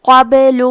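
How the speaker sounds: قبل with the ب bounced, echoing; قبل with a shaken, bouncing ب; recited قبل, echoing ب; qalqala on ba. Shown as a demonstration. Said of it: "حرف البا کو ہلاتے ہوئے یہ کلمہ کو پڑھیں گے".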